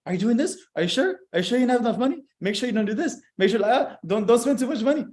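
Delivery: lightly voiced worrying mom, questions rising; worried mom. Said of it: "with my mom, it's like".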